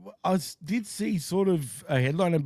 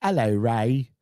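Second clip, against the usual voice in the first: British greeting, sung a little; sing-song British accent; 4 semitones lower, slower than usual. Shown as a demonstration. Co-host shows how the British talk, sing-song British accent. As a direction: demonstrate a singing British lilt. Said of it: "they kinda sing when they talk".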